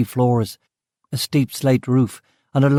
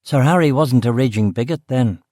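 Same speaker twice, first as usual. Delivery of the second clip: ordinary delivery, nothing marked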